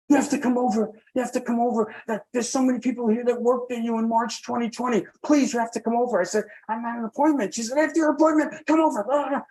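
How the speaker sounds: high-pitched, insistent imitation of an excited nurse; urgent pleading. Nurse urging former patient to come over, urgent pleading. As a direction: mimic her begging, pitched well up.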